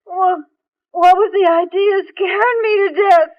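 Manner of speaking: high, loud, tearful scolding; indignant outburst. Acted. Heroine scolding her fiancé through tears of relief. Heroine upbraids hero after scare.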